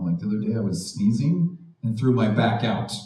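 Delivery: ordinary talk, nothing marked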